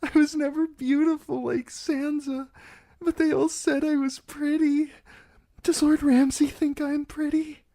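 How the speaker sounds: put-on high, tearful girl voice; frightened bride near tears, asking if she is pretty. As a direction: hold back tears as you ask. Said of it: "Tears spilled from her eyes".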